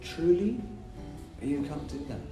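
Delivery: ordinary delivery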